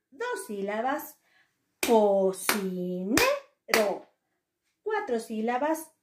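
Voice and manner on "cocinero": Cocinero stretched in syllables, NE punched; stress on third syllable. Shown as a demonstration. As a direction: draw out Cocinero beat by beat, hit NE. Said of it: "tenía mayor fuerza de voz".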